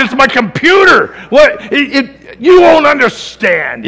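loud, high, sputtering protest; hammed-up shout of frustration; acted exasperation. Father defending his computer rant to his daughter, acted exasperated shouting.

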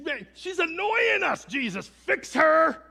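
high, drawn-out yell of annoyance; exasperated shout. Retold or imitated. Annoyed disciples yelling at Jesus about the woman, exasperated shout. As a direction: shout it at full volume, fed up and pleading.

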